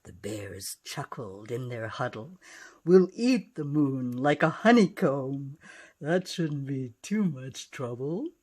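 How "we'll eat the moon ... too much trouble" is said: gruff bear voice. Acted. Bears plotting in story.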